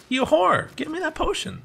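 high-pitched, squawked demand; mock angry demand. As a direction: playfully yell at a game companion for an item. Yelling at game character for potion.

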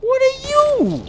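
very high, loud, stretched-out cry; startled alarm. Real, not acted. Player confronting an unknown thing in game, alarmed. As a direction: voice shooting up in disbelief.

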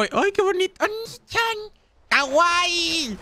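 very high, put-on anime girl voice; high mock-cute fangirl voice; cutesy anime squeal. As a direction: squeal it far up high like a gushing anime character.

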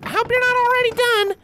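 very high, bright filly voice; cheerful Apple Bloom. A narrator's reading. Apple Bloom greeting the ponies, high and cheerful. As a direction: greet them happily.

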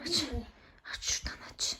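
urgent whisper. Pretend. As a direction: whisper the order tensely. Sneaking up on the dragon.

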